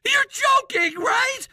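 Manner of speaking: high, shaky, panicked cry; trembling voice. Real, not acted. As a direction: voice trembling as the act slips. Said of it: "That trembling voice of yours gave everything away".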